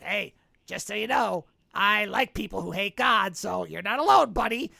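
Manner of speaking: a hint of a friendly monster; lightly voiced fish monster, chummy; fish man voice. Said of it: "like in this kind of voice".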